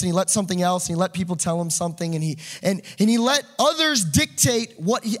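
ordinary delivery

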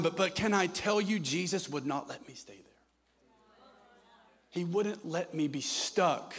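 usual speaking voice

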